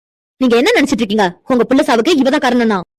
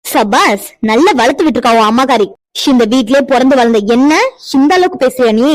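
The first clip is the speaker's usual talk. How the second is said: normal talking voice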